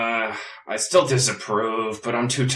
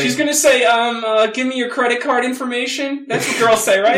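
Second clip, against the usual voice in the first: put-on high falsetto girl voice; jokey woman's voice, up high; man doing a woman's voice; 13 semitones higher than usual. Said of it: "what do girls sound like".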